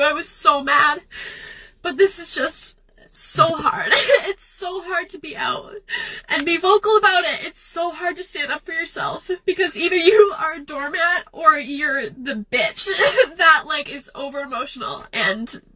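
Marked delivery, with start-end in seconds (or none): 1.8-13.6 tearful laugh, voice cracking; crying while venting. Real, not acted. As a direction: push the words out through tears. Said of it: "fake cry into TikTok".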